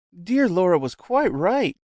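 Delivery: high, slow gushing lady; lightly voiced effusive society lady; cried out. A narrator's reading. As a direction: gush it out with delight. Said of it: "cried the lady".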